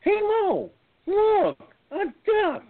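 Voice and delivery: high squeaky cartoon voice; high silly voice. Firefighter joking to his daughter, high cartoon squeak. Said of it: "And he goes".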